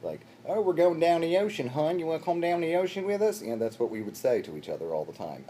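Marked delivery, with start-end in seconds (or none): 0.5-3.4 put-on loud, high Baltimore "hon" accent; mock the strident Baltimore twang. Friends inviting friends down the ocean, strident Baltimore accent. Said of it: "you should put your ears back at that accent".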